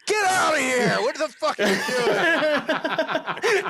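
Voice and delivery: high, loud mock yell, breaking into laughter; imitating a furious yell. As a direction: shriek it like she's booting him out. Imitating Elisa scolding drunk boyfriend.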